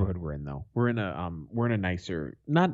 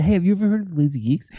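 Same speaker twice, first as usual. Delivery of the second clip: pushy eager pitch; 10 semitones higher, 4 dB louder, faster than usual. Pretend. Acting pushy promoter.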